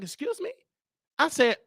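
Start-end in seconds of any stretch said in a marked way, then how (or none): 0.0-0.5 high, soft incredulous question; retold disbelief. Host reacting to money as a pronoun, hushed disbelief. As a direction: squeak it softly, as if taken aback. Acting out puzzled reaction to answer.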